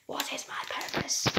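soft whispered robot voice. Acted. Robot asks again.

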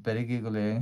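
ordinary delivery, nothing marked

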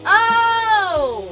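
high, loud, drawn-out oh; loud "oh" of realisation. Real, not acted. Player suddenly getting how arrows work, loud oh. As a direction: belt out a long oh, falling at the end.

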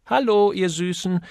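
cheerful, high-pitched greeting; fröhlicher Zuruf. A narrator's reading. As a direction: call out brightly to a group of colleagues. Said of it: "rief Miss Rossiter fröhlich". (fröhlich gerufen)

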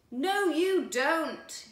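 high, loud, slow shout; loud slow neighbourly yell. A narrator's reading. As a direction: stop them in their tracks. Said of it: "yelled their neighbours".

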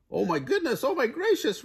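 very high, squeaky chick voice; frantic Chicken Little. A narrator's reading. Chicken Little to the goose he bumped, high frantic rasp. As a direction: panic in a tiny high voice. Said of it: "rasped Chicken Little".

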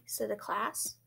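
ordinary delivery, nothing marked

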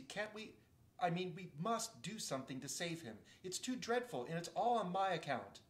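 a lightly voiced pleading young girl; Lucy.

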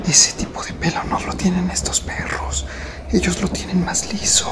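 unvoiced whisper. Real, not acted. Vlogger whispering to viewers near the dog. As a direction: whisper so the dog won't hear.

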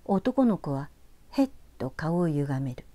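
usual speaking voice

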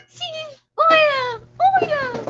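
loud, high squeal on okay lang. Real, not acted. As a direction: squeal it out playfully. Squealing reply to chat.

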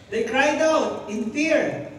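slow, loud, high fearful reading; cried out in fear. Acted. Preacher rereads the verse as a fearful cry. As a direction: stretch it out like a terrified cry. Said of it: "Interpret niyo yung word na cry".

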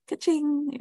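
high laughing ka-ching like a cash register. Acted. Teacher cheers Sasha's correct answer with a laughing ka-ching. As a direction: ring it up with a laugh.

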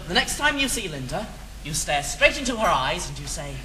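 ordinary talk, nothing marked

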